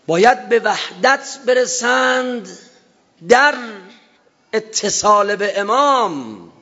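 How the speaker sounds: high, slow, forceful sermon cry; fervent preaching cry; impassioned preaching. Acted. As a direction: lift the voice and stretch each word. Preacher rising to emphasis.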